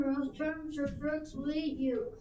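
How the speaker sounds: usual speaking voice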